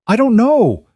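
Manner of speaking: high, loud desperate shout; a captive screaming back at her interrogator. Acted. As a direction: yell it as control fractures. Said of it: "she was shouting now".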